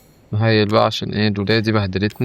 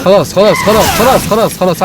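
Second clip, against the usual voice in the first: loud frantic repeated okay okay; panicked pleading; 6 semitones higher, 9 dB louder than usual. Real, not acted. Gamer yelling at the attacker to calm down. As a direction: beg them to stop, shouting.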